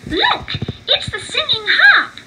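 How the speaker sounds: high, loud excited shout; excited cry. Acted. Mickey cries out on finding the harp, excited. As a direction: burst out with discovery. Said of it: "cried Mickey".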